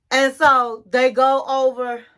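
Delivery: ordinary talk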